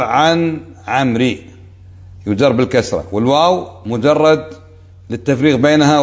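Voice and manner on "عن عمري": عمري said slowly as "ʕamri"; correct case ending. Shown as a demonstration. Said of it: "فيقال".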